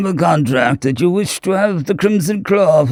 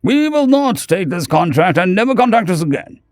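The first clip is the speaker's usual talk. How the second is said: firm; 7 semitones higher than usual. A narrator's reading. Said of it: "said firmly".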